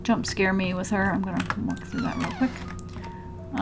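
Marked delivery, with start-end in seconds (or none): 0.0-1.1 nervous pleading whisper; scared horror game streamer. Real, not acted. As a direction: beg under your breath, anxious. Dreading a jump scare in game.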